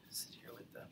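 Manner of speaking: breathy whispered aside. Shown as a demonstration. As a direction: whisper it under your breath. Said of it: "demo of a bad habit".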